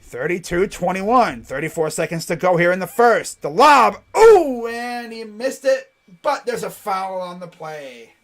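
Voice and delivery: excited basketball commentary that settles after the foul; live play-by-play. Real, not acted. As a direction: call the near miss as it happens.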